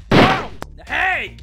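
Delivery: high, loud yelps; startled cries. Acted. Detective caught mid-fight, startled cries. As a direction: yelp in sudden alarm.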